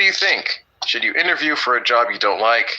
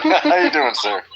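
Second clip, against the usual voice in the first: laughing tease, much higher; slower than usual. Real, not acted. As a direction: chuckle through a playful greeting. Teasing a late student.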